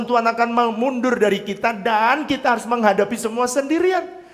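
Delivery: high, loud, impassioned preaching; tearful preacher crying out to the congregation; preaching at full force. Acted. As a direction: pour it out as the sermon peaks. Preacher's emotional climax.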